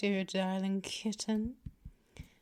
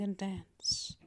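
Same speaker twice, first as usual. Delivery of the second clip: soft teasing whisper; 3 semitones lower, 8 dB quieter than usual. Acted. Mistress whispering to her old prey. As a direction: trail off into a whisper. Intimate close taunt.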